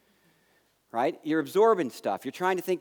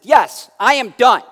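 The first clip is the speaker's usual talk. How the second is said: loud, slow, furious snap; angry retort; 6 semitones higher, 12 dB louder, slower than usual. Retold or imitated. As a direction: hammer each word out in anger. Angry son venting to mom.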